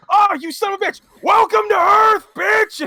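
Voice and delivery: high, loud mock yell, drawn out; hammed-up battle cry. Imitating Sid as he attacks Jenova, yelling. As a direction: holler it like an action hero.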